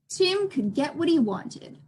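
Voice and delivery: plain reading voice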